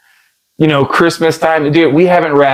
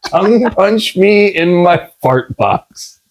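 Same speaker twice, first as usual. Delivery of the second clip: slow, word-by-word comic repeat; deliberate slow-motion mock delivery; drawn-out quote; slower than usual. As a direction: savour each word of the absurd line. Repeats quoted line for laughs.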